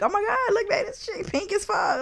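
high laughing excited squeal; mock excited squeal. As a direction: act thrilled at the pink cake. Acted gender reveal excitement.

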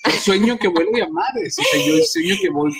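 high excited whoops; excited flying. Pretend. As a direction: squeal like flying in a dream. Acting out flying dream.

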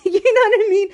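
chuckling guiltily at yourself; laughing. Real, not acted. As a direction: laugh it off, sheepish. Laughing off his own sleaziness.

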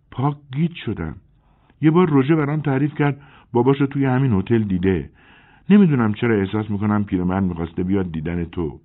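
a woman puzzling it out aloud; confused woman. A narrator's reading. Celine confused. (زن گیج و سردرگم، صدای بالاتر)

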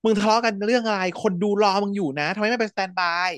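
high mock yell to break it up; retold order to stop fighting, voiced well above her own pitch; stopping a fight. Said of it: "จนการก็เลยต้องห้าม".

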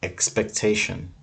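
normal talking voice

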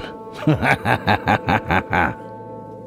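long knowing villainous laugh; sinister chuckle. Acted. Host wishes the crew well, sinister chuckle. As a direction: chuckle on and on, ominously. Spooky host ends his introduction.

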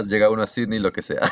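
ordinary delivery

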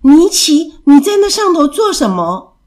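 put-on high, loud child's cry of surprise; surprised calling out. A narrator's reading. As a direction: call up to a toy you've just spotted. Child calls up to lost toy.

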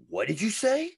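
indignant mock comeback; mock confrontational. Acted challenge to a heckler.

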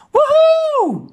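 very high, drawn-out whoop of joy; excited squeal. A narrator's reading. Boy seeing the bird on screen, squealing with excitement. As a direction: whoop with delight, pitch soaring. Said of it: "Mats gilde het uit".

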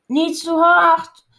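slow, stretched call to slow him down. A narrator's reading. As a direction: shout it to the dog running ahead. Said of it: "roept Lady".

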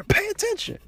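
very high, loud, furious Pay attention; retold angry shout. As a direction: bark Pay attention at her at full volume. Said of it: "I'm hot".

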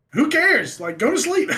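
high, loud imitated dismissal; exasperated mom. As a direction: re-enact mom brushing it off. Mom's dismissive reply, acted.

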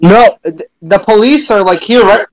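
ordinary talk

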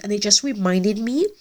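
normal talking voice

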